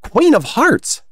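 high, slow, loud naming; voiced, unwhispered secret; mock confidential announcement. A narrator's reading. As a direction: draw out the title, pitched high. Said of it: "said the Rabbit in a whisper".

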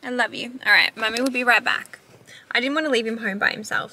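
ordinary delivery

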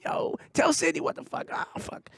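whispered gamer trash talk; mock gamer whisper. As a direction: hush it like a gamer at night. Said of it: "this motherfucker's playing video games".

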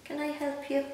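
a light touch of a gentle rabbit; kind rabbit. Acted. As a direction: ask it like a kindly bunny. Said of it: "he's saying".

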